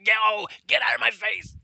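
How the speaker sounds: loud barked order, pitch jumping high; furious drill instructor shouting at the recruit; drill instructor yelling. Retold or imitated. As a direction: yell him out of your face. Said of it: "It's like".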